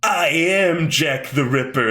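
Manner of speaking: slow, drawn-out declaration; imitating a dramatic villain announcement; mock villain reveal. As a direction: stretch it out like a hammy killer's unmasking. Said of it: "And he's like".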